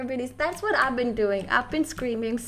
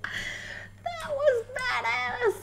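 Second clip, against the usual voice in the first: very high, drawn-out squeal; slow, squeaky amazement; thrilled squeal; 11 semitones higher, much slower than usual. Real, not acted. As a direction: stretch it out in high-pitched awe. Thrilled after a game kill.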